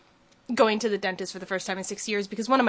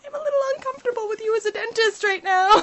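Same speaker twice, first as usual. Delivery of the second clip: high, half-laughing unease; mock uneasy; 11 semitones higher, 4 dB louder than usual. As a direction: squeak out your discomfort. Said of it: "I'm like".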